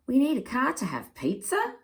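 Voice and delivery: surprised cry. Acted. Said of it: "cried Pa".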